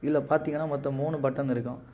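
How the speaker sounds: usual speaking voice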